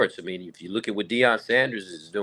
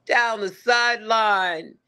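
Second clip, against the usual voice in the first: much higher, slow, drawn-out touchdown call; mock play-by-play call; 10 semitones higher, much slower than usual. Young sportscaster calling a high school touchdown, excited slow call. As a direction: stretch it out like a rising stadium call. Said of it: "And I go".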